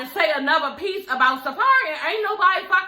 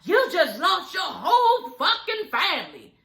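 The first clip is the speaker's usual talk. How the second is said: imitating Erica; 5 semitones higher, slower than usual. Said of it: "telling [name]".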